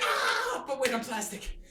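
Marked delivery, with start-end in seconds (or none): 0.0-0.6 very high imitated shriek; a high cartoon yelp; mock scream. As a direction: shriek like a toy being shoved off. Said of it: "And Archer's like".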